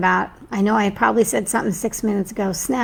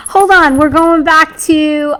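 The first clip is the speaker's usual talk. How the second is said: excited; 6 semitones higher, 8 dB louder, slower than usual. Real, not acted. Excited interruption.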